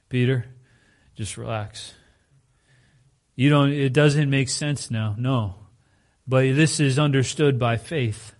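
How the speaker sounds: ordinary talk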